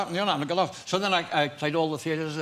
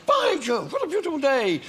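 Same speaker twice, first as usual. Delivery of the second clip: high, loud hearty cheer; jolly greeting; 11 semitones higher, 4 dB louder than usual. Retold or imitated. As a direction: burst in like a jolly old uncle. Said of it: "I come and say".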